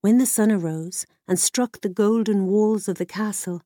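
usual speaking voice

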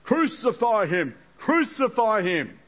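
a touch of a mob's cry; mild mock shout. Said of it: "began shouting".

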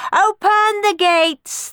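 the Furies order the gates open; drawn-out booming command. A narrator's reading. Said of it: "they commanded".

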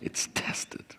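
a hushed, breathy whisper. Real, not acted. Whispering to the congregation that it gets tested, hushed. Pastor stressing believers get tested.

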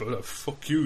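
usual speaking voice

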